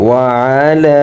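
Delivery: "وعلى" with a long final aa; one-alif elongation. Shown as a demonstration. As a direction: hold the last vowel of "وعلى". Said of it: "এখানেও এক আলিফ টান হবে".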